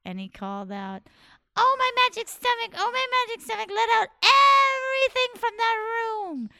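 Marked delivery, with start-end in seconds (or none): 1.5-6.5 high, loud chanting call; high rooster incantation. Acted. Rooster summoning the bees from his stomach, high call. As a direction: call out the spell in a squeaky bird voice. Rooster spell third time.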